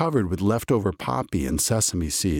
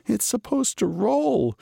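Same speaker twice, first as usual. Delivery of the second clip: muttering. A narrator's reading. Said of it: "he muttered".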